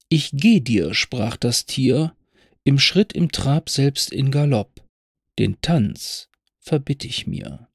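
slow, measured ballad reading; poem recitation. Acted. Narrator reciting Pfeffel's poem to listeners, slow and measured. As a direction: recite it like an old story poem.

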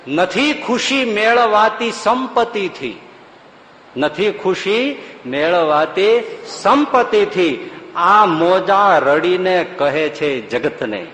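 slow devotional recitation; recited verse. Acted. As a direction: intone the lines like a poem.

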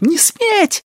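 very high, piercing cry; frantic high-pitched command; screaming. A narrator's reading. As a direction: keep screaming it in the thick of a fight. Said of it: "не переставая кричать".

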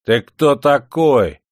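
slow, loud, high challenge; attacking challenge. Acted. Drunk Ermilka confronts Perstень, slow and loud. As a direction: lunge at him with the words. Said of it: "накинулся на него с кнутом".

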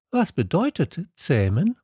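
high, questioning prince voice; little prince. A narrator's reading. Little prince asking the fox, high questioning voice.

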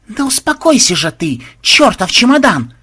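high, loud exasperated yell. A narrator's reading. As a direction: yell at a beast that won't keep still. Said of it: "орал ксенобайт".

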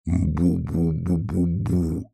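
slow, droning boo-boo-boo; barrel droning. A narrator's reading. Bear cub answering hare from barrel, slow drone. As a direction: hum it out like a hollow barrel. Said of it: "снова загудела бочка".

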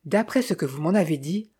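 usual speaking voice

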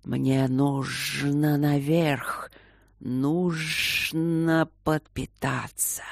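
drawn-out, very slow hiss; hissing wind serpent. A narrator's reading. Air spirit to Luke after the flight, slow hissing. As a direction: hiss it out slowly, stretching the sounds. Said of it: "зашипел".

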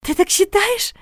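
high, delighted naive question; wide-eyed delight. A narrator's reading. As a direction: ask with starry-eyed hope. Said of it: "Регина восторженно захлопала глазами".

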